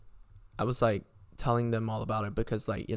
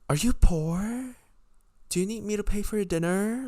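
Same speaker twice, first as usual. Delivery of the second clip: higher-pitched mocking questions aimed at her; sarcastic taunt; 9 semitones higher than usual. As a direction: sneer it, as if offering charity. Ranting at Vicky over the bill.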